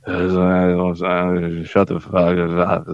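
slow, dazed mumble like you're barely awake; a sleepy, fed-up mock snarl at the dog; groggy half-asleep grumble. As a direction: drag the words out, still half asleep. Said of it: "You're just".

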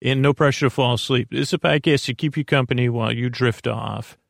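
normal talking voice

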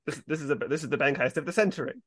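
ordinary talk, nothing marked

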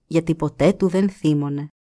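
plain reading voice

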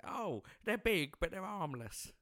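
put-on high, slow sing-song of a grown-up soothing kids; mimicking a reassuring grown-up. Co-host mimics people reassuring kids about bees, high and slow.

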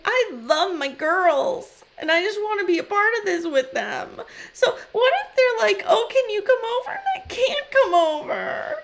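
mock sobbing teen wife pleading; whiny teen daughter. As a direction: whine it like a sulky teenager near tears. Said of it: "channeling my fifteen-year-old daughters here".

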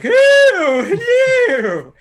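very high, loud, drawn-out hooting hello; piercing falsetto wizard call. Acted. Wizard vendor greeting the players, high loud wizard voice. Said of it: "the wizard voice was always Wizard Vendor's".

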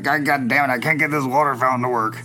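lightly voiced grumbling old roadie; a hint of crusty old-timer complaining. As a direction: imitate a weathered old Deadhead, exasperated. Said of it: "he talks like this".

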